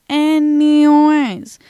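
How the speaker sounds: very slow, loud, drawn-out anyways; stretched anyways. Real, not acted. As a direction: drag the word out to move on. Drawn-out topic change.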